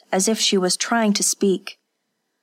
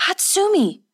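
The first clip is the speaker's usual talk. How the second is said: high, sharp call of her name; crying out; 12 semitones higher than usual. Acted. Kazuko crying out to Hatsumi mid-beating, high alarmed call. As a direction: cry out to stop her. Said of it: "Kazuko cried".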